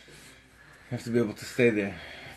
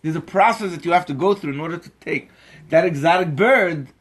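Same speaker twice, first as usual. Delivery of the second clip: higher, louder preacher driving the point home; forceful preaching; 8 semitones higher, 8 dB louder than usual. Acted. Teacher lecturing the bird seller, forceful preaching. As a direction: preach it, pressing each line harder.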